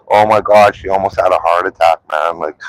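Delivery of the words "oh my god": ordinary talk, nothing marked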